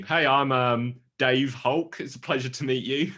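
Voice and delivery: lightly voiced dorky Hulk greeting; a hint of a nerdy Hulk. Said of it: "it's like the Hulk being".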